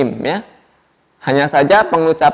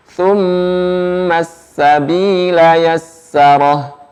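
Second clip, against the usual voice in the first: slow, chanted Quranic recitation; 3 semitones higher, much slower than usual. Shown as a demonstration. Teacher models the verse for students, slow chant. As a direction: recite it slowly, each letter placed.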